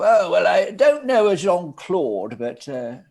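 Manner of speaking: hammed-up grand hostess letting a stranger in; posh party host. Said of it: "And they'd go".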